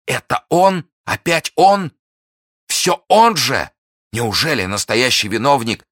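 loud, very high incredulous questions; agitated disbelief. A narrator's reading. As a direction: cry out, stunned, voice pitched right up. Felton's agitated disbelief at the culprit.

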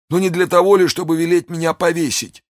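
very high, fearful character voice; disbelieving wounded soldier. A narrator's reading. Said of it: "сказал раненый, который не мог поверить такому великодушию".

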